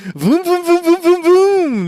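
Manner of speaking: high loud voom voom; revving engine noise. Pretend. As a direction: rev it up like a spinning engine. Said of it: "I don't know what sound you made".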